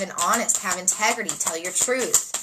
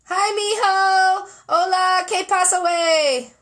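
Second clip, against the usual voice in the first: a much higher, louder, slow, playful character voice saying hi to mijo; put-on greeting voice; 9 semitones higher, 6 dB louder, slower than usual. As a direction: ham it up like a cheery auntie.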